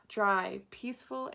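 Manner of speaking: ordinary delivery, nothing marked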